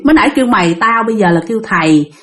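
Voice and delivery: ordinary delivery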